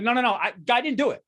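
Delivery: high, loud panicked pleading; frantic innocent defendant; desperate denial. Pretend. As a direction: beg the judge in a panic. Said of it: "I'd be like".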